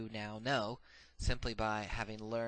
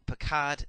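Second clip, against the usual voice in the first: "pakad" with the weight on kad; second syllable of "pakad" drawn out and stressed; 5 semitones higher, 6 dB louder than usual. Shown as a demonstration. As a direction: say "pakad" slowly, accenting the last syllable, kad. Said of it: "the accent is on the syllable, second syllable".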